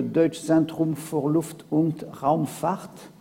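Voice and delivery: self-conscious German accent on the name, drawn out at the end; a French lecturer trying a German accent, stretching Raumfahrt. Shown as a demonstration. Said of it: "excusez mon accent allemand qui est sûrement piètre".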